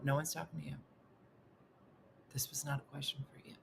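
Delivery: mumbled scold; mumble. Retold or imitated. Narrator scolding Missy, mumbled. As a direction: mutter it under your breath. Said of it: "look at her and mumble".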